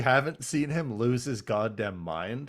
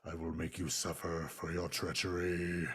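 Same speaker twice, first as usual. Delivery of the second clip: much lower, softer ominous rumble; deep menacing god voice; 7 semitones lower, 10 dB quieter than usual. Retold or imitated. Unicron threatening Galvatron, low and soft. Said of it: "And he's like".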